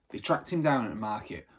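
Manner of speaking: usual speaking voice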